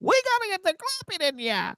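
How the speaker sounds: high vintage radio announcer; old-timey radio voice. Acted. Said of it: "I thought it was like an old-timey radio voice".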